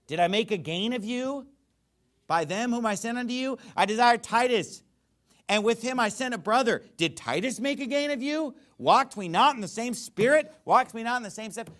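high-pitched, challenging rhetorical questions; sarcastic. Acted. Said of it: "So he's being sarcastic".

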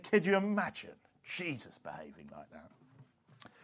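very low, quiet, slow mock dismay; disapproving old pastor. Preacher imitating a shocked old pastor, hushed and low. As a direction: murmur it slowly in scandalised disbelief. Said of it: "He said".

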